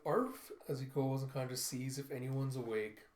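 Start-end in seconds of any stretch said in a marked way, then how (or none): 0.0-0.5 dog bark. Retold or imitated. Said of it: "you see him kinda going".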